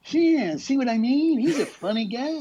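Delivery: Dylan cracking up as he answers; Dylan laughing. Retold or imitated. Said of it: "turns around and laughs and goes".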